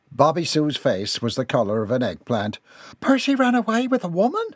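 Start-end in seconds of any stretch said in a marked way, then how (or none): 3.0-4.5 high-pitched scandalized mother; shocked disbelief. A narrator's reading. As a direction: blurt it out, high and incredulous. Mother shocked by son's elopement.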